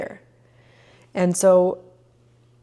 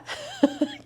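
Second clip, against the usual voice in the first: giddy breathy laugh; 7 semitones higher, 6 dB quieter than usual. Real, not acted. Giddy after temple realization.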